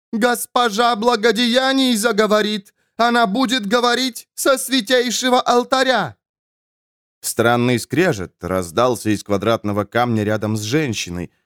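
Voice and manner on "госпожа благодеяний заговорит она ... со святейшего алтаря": high, sustained zealous cry; proclaiming nun. A narrator's reading. Nun heralds the Lady speaking from the altar, proclaiming. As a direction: intone it high like a holy announcement.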